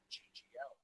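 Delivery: ordinary talk, nothing marked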